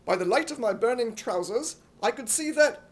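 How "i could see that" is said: usual speaking voice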